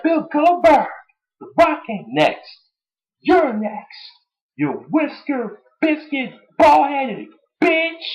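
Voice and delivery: high, drawn-out mock insult; The Rock impression. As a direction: stretch the final insult up high. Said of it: "the closing line saying".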